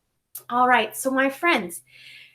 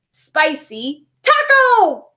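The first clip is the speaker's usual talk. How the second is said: delighted cry; 4 semitones higher, 5 dB louder, much slower than usual. Acted. Penguin celebrates with taco.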